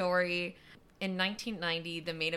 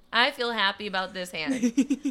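loud, lightly mocking friend impression; imitated clueless friend; 11 dB louder than usual. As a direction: play a happily clueless poker player, on the edge of laughter. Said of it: "And she's like".

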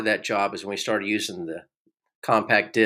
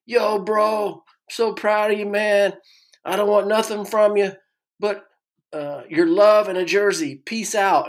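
stepbrother voice; 11 semitones higher, 4 dB louder than usual. Retold or imitated. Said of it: "And he goes".

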